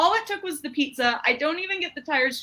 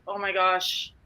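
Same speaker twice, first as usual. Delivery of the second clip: smug pity; 5 semitones lower than usual. Retold or imitated. Watching a family with a screaming kid, low pitying mutter. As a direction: mutter it, glad it's someone else. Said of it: "and you're like".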